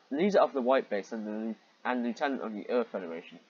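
normal talking voice

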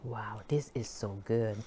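low soft mouthful murmur; quiet murmur. Real, not acted. Eating, murmured.

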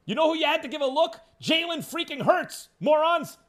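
angry shout, pitch way up; really mad. Real, not acted. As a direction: scream it at the front office. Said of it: "that's how I say Jalen Hurts when I'm really mad".

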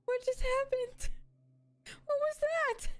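high startled confusion; baffled surprise. Real, not acted. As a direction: thrown by what happened. Confused after game event.

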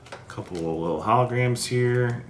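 ordinary talk, nothing marked

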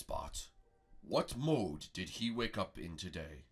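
low, deliberate master; slow translated question. Pretend. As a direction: pose it gravely, word by word. Prego translates dragon's question.

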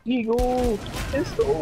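high, loud battle callouts; urgent callout. Real, not acted. Calling out in firefight.

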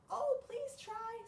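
high, slow pleading bird. Acted. Bird begs the animals to try, high and slow. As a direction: coax them gently as the bird. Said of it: "said the bird".

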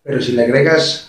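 usual speaking voice

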